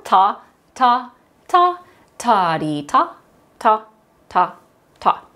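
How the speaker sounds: spoken on a steady beat, evenly spaced; rhythm syllables. Shown as a demonstration. Teacher leads class on rhythm syllables, chanting. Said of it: "Let's speak it on rhythm, syllables".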